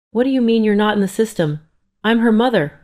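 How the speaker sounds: high, loud, upset disbelief. Acted. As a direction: push back in distress. Mother refused entry, upset.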